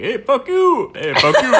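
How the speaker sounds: loud, high New York yelling; New York accent. Acted. Frogs arguing outside at night, shouted New York accent. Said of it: "That was more of a New York accent".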